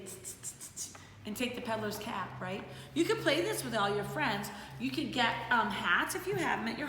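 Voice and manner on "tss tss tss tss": whispered teasing tsk tsk; kooky monkey tsk. Shown as a demonstration. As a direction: show the kids the monkey sound. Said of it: "you could be this kooky monkey and say".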